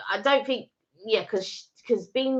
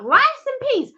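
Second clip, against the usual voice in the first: much higher disbelieving "Rice and peas?"; incredulous question; 5 dB louder than usual. Retold or imitated. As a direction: act baffled by "Rice and peas?". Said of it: "and you're like".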